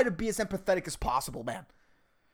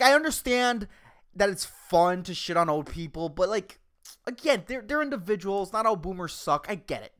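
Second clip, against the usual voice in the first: ordinary talk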